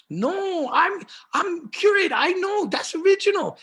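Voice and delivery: very high, insistent mock Korean accent. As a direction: imitate an indignant curator in squeaky-high Korean-accented English. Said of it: "in her Korean accent".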